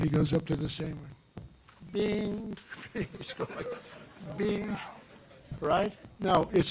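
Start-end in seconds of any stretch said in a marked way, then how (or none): 1.9-2.6 a high, light "bing"; a comic high "bing" for an easy lift; mock lift sound. Imitating effortless barbell lift. 4.4-4.8 a high, light "bing"; a comic high "bing" for an easy lift; mock lift sound. Imitating effortless barbell lift.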